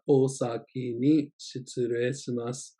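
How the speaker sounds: "Osaki ni shitsurei shimasu" said slowly and clearly; slow it down for learners; careful teacher demo; slow model take. Shown as a demonstration. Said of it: "natural then slow demo".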